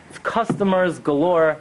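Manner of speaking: ordinary delivery, nothing marked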